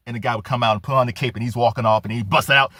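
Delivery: ordinary talk, nothing marked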